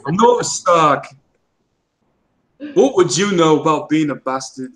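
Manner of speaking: put-on northern English accent, fuller voice; hammed-up northern English accent; mock Jon Snow accent. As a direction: play it indignant in northern English. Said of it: "if you're doing your cosplay".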